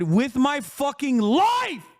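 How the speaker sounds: rising to a high, drawn-out cry; impassioned vow. Real, not acted. Streamer swearing to defend Poland and the studio, impassioned cry. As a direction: cry out the last word, slow and high.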